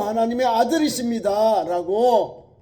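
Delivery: loud, high, slow proclamation; Peter's confession. Acted. Peter declaring Jesus the Son of God, slow and loud. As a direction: declare each word solemnly. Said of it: "베드로가 고백을 합니다".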